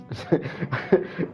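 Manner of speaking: short airy laugh at a slip; quick unvoiced giggle; breathy laugh. Real, not acted. Laughs at own misreading.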